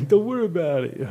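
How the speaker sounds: laughing, hammed-up brush-off; laughing repeat. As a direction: blurt it out again, cracking up.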